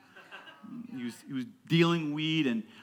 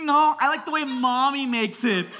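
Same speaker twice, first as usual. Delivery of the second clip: high, loud fussy-kid imitation; picky child; 10 semitones higher, 8 dB louder than usual. Daughter rejecting dad's sandwich, picky child. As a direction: protest like a stubborn six-year-old.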